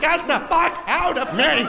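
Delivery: high, strained, exasperated shout; mock desperate yell. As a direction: yell at the baby to come out. Acts labouring mother yelling at baby.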